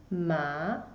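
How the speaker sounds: "ma" low and rising; "ma" climbing up from low; second tone ma. Shown as a demonstration. Teacher drilling the rising tone, "ma" rising. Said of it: "it goes up".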